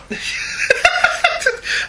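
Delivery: shrieking laugh; high burst of laughter. Real, not acted. Laughs at Iceman joke.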